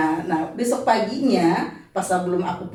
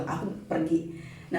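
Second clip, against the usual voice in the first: ordinary delivery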